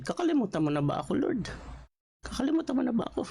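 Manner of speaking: rising, anguished plea; pleading lament. Retold or imitated. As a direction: beg God not to forget you. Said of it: "Parang ganito yung sinabi ni David".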